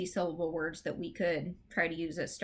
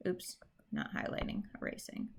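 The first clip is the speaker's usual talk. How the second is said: muttered aside; 9 dB quieter than usual. Real, not acted. Muttered aside over pen mishap.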